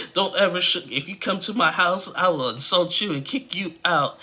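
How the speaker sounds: a retold tirade, high and loud; furious mother. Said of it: "Submit's mom was like".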